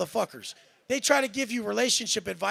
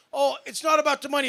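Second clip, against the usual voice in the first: mocking a fake-humble claim, a little higher and louder; mocking quote; 5 semitones higher, 5 dB louder, faster than usual. Mocking what rich people claim, in a sneering voice. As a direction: sneer it back like a cliché he hates. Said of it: "this is what people say".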